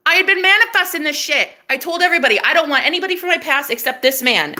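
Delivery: high, emphatic testifying; excited boasting. Real, not acted. Woman telling everybody she manifested him, excited boasting. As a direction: proclaim it in triumph.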